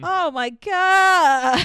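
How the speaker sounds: slow, drawn-out gasp of disbelief; slow stunned exclamation; shocked oh my god. Real, not acted. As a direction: stretch it out in shock. Reacting to creepy villain news.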